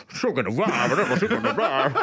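imitating a deep rap voice over the phone; super deep rapper. Rapper on the other end of a phone call, super deep voice. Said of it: "it was him rapping on the phone".

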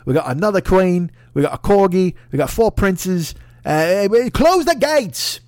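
high, loud, slow bragging Brit; pompous English boast. Pretend. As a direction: list the national treasures with swelling pride. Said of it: "you're like".

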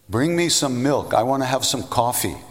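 high, loud holler across a rooftop; loud yell. Retold or imitated. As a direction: yell an order out to your followers. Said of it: "in a really loud voice, he yells".

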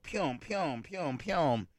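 soft, high little "pyom" sounds; imitated jab noises. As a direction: mimic quick needle pokes, softly. Imitates the needle jabs in story.

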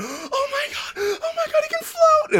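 high amazed shriek; shocked shriek. Retold or imitated. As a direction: squeal in disbelief. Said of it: "they both went".

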